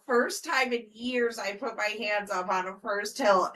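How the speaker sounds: normal talking voice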